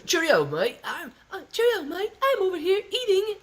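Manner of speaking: very high falsetto with a put-on "cheerio, mate" accent; mock foreign mate in squeaky falsetto; Lauren. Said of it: "Lauren's voice would be more like".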